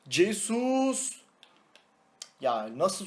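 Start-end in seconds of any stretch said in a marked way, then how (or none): 0.1-1.2 excited name call. Real, not acted. Gol sevinciyle isim haykırılıyor.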